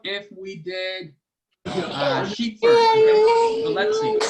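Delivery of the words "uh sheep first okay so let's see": high, loud excited call. Real, not acted. Excited guess in kids' trivia.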